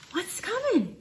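hushed, high little question; small quiet voice. Acted. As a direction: ask it softly and curiously. A small creature asks.

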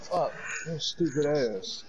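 ordinary talk

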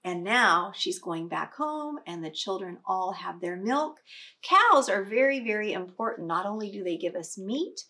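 usual speaking voice

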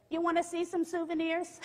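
imitating a grade-school teacher; schoolteacher coaxing child. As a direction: coax a bratty kid sweetly. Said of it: "she is a school teacher".

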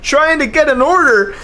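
hyped commentator shouting the driver's name; excited yelling. Real, not acted. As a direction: lose it at the meanest block ever. Caller reacts to a wild block.

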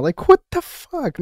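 loud, high, slow what the fuck; outraged disbelief. Real, not acted. Outraged at the China flag in the clip, loud high disbelief. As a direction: blurt out in disbelief.

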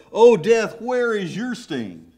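soaring, loud preacher's cry on "Oh, death"; proclaiming. Acted. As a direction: call it out to the whole church. Preacher proclaims scripture quote.

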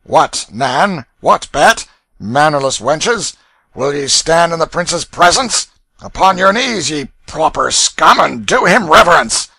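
loud, high, jeering ruffian; bullying mockery. A narrator's reading. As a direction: bellow mock-outraged orders at the girls. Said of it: "followed this with another horse laugh".

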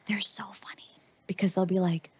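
hushed, secret aside; conspiratorial whisper. Real, not acted. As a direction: whisper it so the absent ones won't hear. Secret aside about Americans.

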